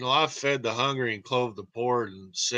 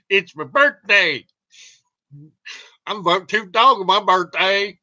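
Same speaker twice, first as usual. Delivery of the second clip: higher, louder, gleeful announcing; excited birthday cheer; 7 semitones higher, 8 dB louder than usual. Real, not acted. To viewers, announcing his birthday with loud glee. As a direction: crow about your birthday.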